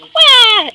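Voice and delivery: very high, loud mock wail; a shrill bird cry. The Edo bird crying out, very high and loud. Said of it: "the Edo's like".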